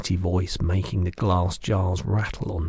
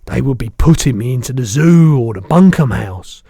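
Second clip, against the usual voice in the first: the giant's voice; 6 semitones higher, 12 dB louder than usual. Acted. Giant correcting the girl, big booming voice.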